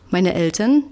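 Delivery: plain reading voice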